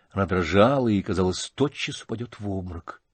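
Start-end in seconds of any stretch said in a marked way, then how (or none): none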